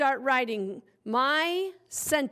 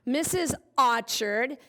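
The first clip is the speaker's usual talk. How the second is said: ordinary delivery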